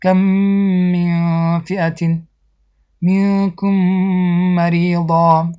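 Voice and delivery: very slow recitation, holding the joined m in منکم مریضا; merged nasal m. Shown as a demonstration. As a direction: join the m's of کم من into one long nasal hum.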